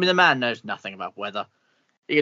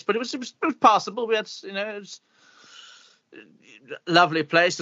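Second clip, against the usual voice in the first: lofty toff; 7 semitones higher than usual. Acted. Posh character recalls Oxford.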